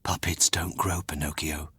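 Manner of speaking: soft whispered fairy; hushed fairy whisper. Acted. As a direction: breathe it to him gently. Fairy gently corrects Pinocchio.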